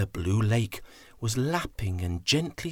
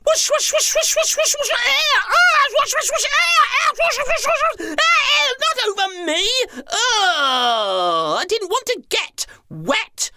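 very high, loud squealing whooshes; 5 dB louder than usual. Acted. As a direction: shriek with delight as water sprays. Elephants squirting, squealing.

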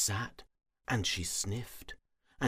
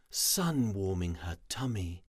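ordinary delivery, nothing marked

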